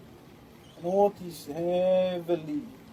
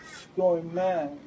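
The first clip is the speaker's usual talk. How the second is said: normal talking voice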